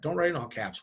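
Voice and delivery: usual speaking voice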